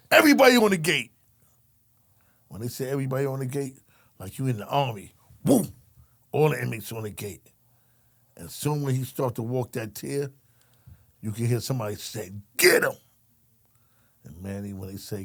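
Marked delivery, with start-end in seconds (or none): 0.1-1.1 loud, fast inmate call; rally the whole tier with a yell; a fast loud command shouted down the tier. Retold or imitated. 12.6-12.9 loud call to attack; a loud mock call to attack; retold shout. Inmate calling out.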